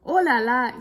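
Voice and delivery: put-on astonished at the flying boy; exclaiming. A narrator's reading. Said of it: "s'exclame Steve".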